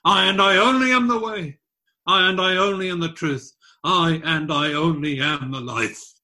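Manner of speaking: I and I only pressed hard, high and loud; emphatic Greek rendered aloud. Shown as a demonstration. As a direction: stress I and I only, easing down each time. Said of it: "the Greek is emphatic".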